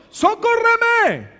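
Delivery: drawn-out desperate plea; high pleading cry. Acted. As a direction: beg for help. Woman's plea.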